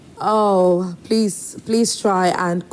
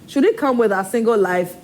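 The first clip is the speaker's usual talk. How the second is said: normal talking voice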